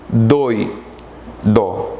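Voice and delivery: doi, then dó, with a clear pause between; syllable by syllable. Shown as a demonstration. Teacher splits doido for pupils, doi and dó said apart. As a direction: break the word into doi and dó. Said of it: "Doi está na mesma sílaba".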